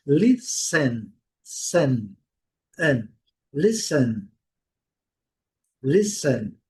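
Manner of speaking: listen with no t sound; silent t in listen. Shown as a demonstration. Teacher modeling listen for students. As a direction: say listen as lis-en, leaving out the t. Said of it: "sem pronunciar o T".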